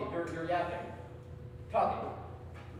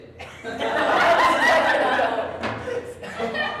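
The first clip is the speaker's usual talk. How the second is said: loud laugh; 7 semitones higher, 6 dB louder than usual. Real, not acted. Laughs at the guess.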